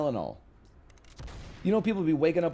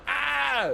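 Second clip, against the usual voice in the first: "Ah" as a sharp, much higher pained cry; high yelp; 12 semitones higher than usual. Shown as a demonstration. Showing chat the yelp he did not do, a high "Ah". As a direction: yelp "Ah" up high like a big drama. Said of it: "I wasn't going".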